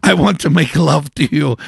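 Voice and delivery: hammed-up Brazilian lover's accent; imitated Brazilian accent. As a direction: declare love in a dubious Brazilian accent. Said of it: "I don't know what accent that was".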